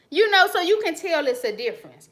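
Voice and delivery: normal talking voice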